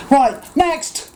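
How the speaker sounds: mock of a cashier calling the next customer; mimicking curt cashier. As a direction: order the queue forward, curtly. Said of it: "and he goes".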